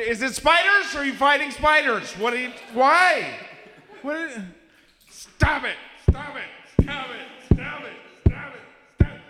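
high-pitched, frantic mock yelling; hammed-up hysterical hollering; screaming at the wall. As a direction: shriek in bewildered protest at the neighbor. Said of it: "I scream at the wall".

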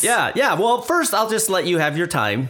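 high, smug mock voice; condescending man. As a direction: humour her with fake patience. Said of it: "us men are sitting there like".

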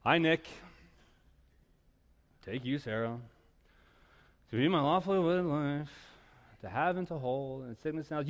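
half-hearted, disengaged wedding vows; bored. Pretend. Groom reciting vows to his bride, acting bored. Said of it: "Why are you so bored?".

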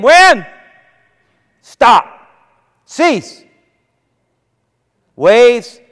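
loud, high, single-word orders with gaps between; rebuking shout at the storm; sharp command. Acted. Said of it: "he spoke sharp words to it".

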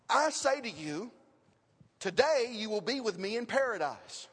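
"today" opens "you will be with me in paradise"; pause before TODAY. Shown as a demonstration. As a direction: stop after "I say to you", then start afresh on "today". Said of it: "But notice where the comma goes".

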